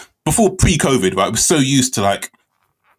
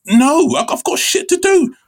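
high, sharp brush-off; high exasperated refusal to the girlfriend; irritated retort. Retold or imitated. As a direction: snap it back, annoyed. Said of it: "It's like".

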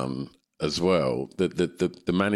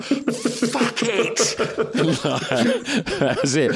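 laughing in defeat; much slower than usual. Retold or imitated. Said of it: "I was like".

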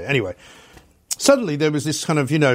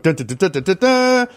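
hummed fanfare; 6 semitones higher, 4 dB louder than usual. Retold or imitated. Imitating the studio fanfare.

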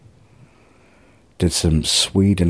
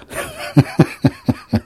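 airy giggle; 10 semitones higher than usual. Real, not acted. Laughing at his own joke.

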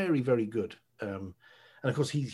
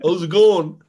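a much louder, hearty "How's it going?"; blokey greeting; 7 semitones higher, 16 dB louder than usual. Acted. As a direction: greet him like a mate down the pub. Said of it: "talking to a man".

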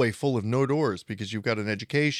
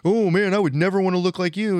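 a heckler's jeer, pitched up and louder; mocking heckler; 6 semitones higher, 5 dB louder than usual. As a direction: scoff at Arnold's body. Quoting a guy to Arnold.

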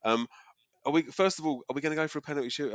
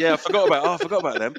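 mock surprise; 5 semitones higher, 11 dB louder, faster than usual. Mock realising forgotten teams.

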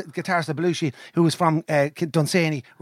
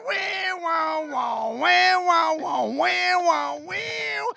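high looping wah-wah noises; imitated guitar wail; 11 semitones higher, much slower than usual. Retelling the cover's solo, high mouthed guitar. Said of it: "And then he went".

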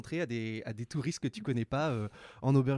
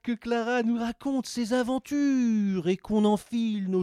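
bright, higher host read, slowing down; podcast host intro; 6 semitones higher, 4 dB louder, slower than usual. Acted. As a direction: welcome listeners like a radio host. Animateur lit l'intro du podcast. (lecture d'intro d'animateur, plus aiguë et posée)

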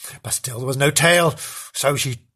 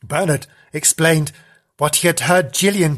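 plain reading voice

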